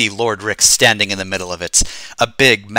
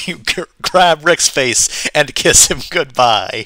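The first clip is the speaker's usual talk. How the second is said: cracking up, higher-pitched narration; laughing while reading; 6 semitones higher than usual. Real, not acted. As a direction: try to read on through real laughter. Cracks up reading the absurd scene.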